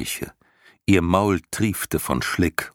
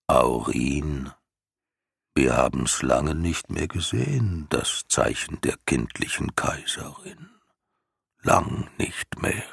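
put-on old turtle voice; 3 semitones lower than usual. Morla speaks to herself.

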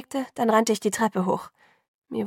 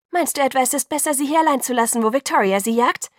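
fast, high, loud retort; angry challenge; 5 semitones higher, 5 dB louder than usual. A narrator's reading. Alice argues with Jacob about Victoria's hunt, angry. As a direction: fire the question back at him.

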